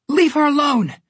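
high, loud desperate yell. A narrator's reading. Jack pinned to the pillar, yelling to protect Goldilocks. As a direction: shout it while straining against the ice. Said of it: "Jack yelled".

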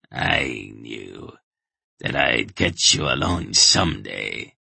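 low, menacing villain voice; deep, ominous threat from an old enemy; Brill. A narrator's reading. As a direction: gloat over cornered prey, pitched well down. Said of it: "Brill said".

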